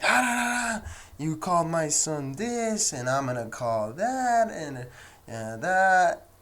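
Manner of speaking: mocking a Karen. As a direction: parody an entitled complaining lady. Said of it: "you know, Karen stuff".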